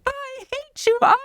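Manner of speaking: very high, thin mock tantrum cry of a child; imitated child screaming. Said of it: "you know, screaming".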